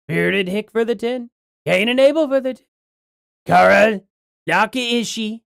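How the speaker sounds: lightly put-on presidential accent; Donald Trump. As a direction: read out names as a light political impression. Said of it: "in a Trump accent".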